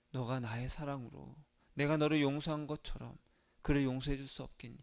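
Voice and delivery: soft, low, gentle appeal; faint inner voice. Acted. Jesus asking a believer to forgive, in a faint, low voice. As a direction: ask quietly, like a voice barely heard within. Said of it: "라는 그 희미한 음성이 있어요".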